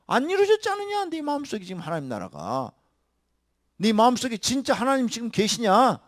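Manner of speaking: 하나님 hammered in a loud high cry; fiery preaching. Acted. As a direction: confront them at full voice. Preaching at full force.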